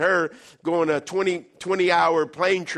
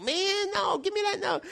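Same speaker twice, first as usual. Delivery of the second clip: much higher protest; snatch it back, flustered; poor man grabbing his list, high; embarrassed poor man; 11 semitones higher, 4 dB quieter than usual. Retold or imitated. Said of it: "He goes".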